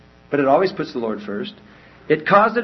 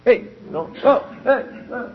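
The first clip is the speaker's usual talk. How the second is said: acting distracted; 7 semitones higher than usual. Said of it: "ten people all standing around him".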